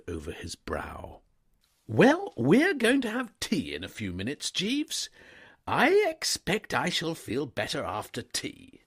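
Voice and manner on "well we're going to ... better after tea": put-on higher, louder young gentleman; an easygoing young master stopping his valet; breezy upper-class gent, pitched up; young toff. A narrator's reading. Wooster hoping tea helps.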